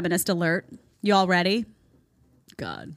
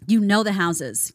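usual speaking voice

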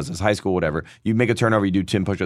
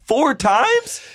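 incredulous. Real, not acted. Incredulous echo of shirt story.

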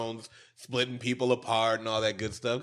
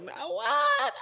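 mock innocent, much higher; playing coy. Boy playing coy with the big brother. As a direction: feign not knowing, high and light. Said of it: "he tried to play coy".

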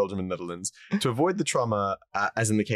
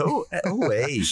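high, tentative Canadian greeting; mock Canadian accent; 9 semitones higher than usual. Host trying out Canadian greetings, mock Canadian accent. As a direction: have another stab at sounding Canadian. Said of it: "what do Canadians say?".

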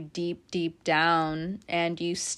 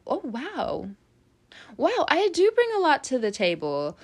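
amazed realisation; 6 semitones higher than usual. Acted. Voices listener's sudden realisation.

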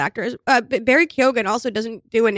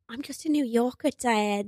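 lightly mocked failed American accent, softer; Nicole Kidman; 7 dB quieter than usual. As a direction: imitate an actress failing at an American accent. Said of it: "refuses to do an American accent".

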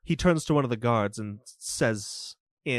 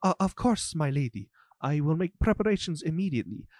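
ordinary talk

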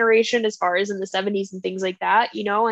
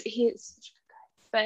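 soft, slow whisper; hushed, slow praise; 7 dB quieter than usual. Real, not acted. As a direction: breathe it out fondly. Gushing about a beloved character.

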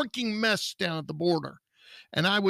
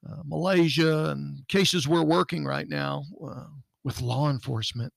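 usual speaking voice

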